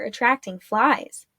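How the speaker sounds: ordinary delivery, nothing marked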